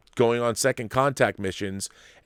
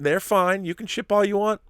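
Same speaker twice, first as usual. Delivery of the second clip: ordinary talk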